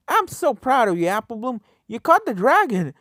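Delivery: high, loud cheering praise; excited shout. Acted. Friends cheering the filly after the catch, excited shout. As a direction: cheer her on at the top of your voice. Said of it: "Rainbow Dash and Scootaloo both shouted".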